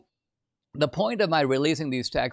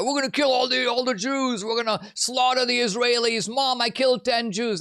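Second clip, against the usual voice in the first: high, gleeful mock fanatic; Hamas impression; 9 semitones higher than usual. Pundit mocking Hamas with a high, hammed-up voice. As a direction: ham up a boastful militant. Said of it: "So Hamas is right out there".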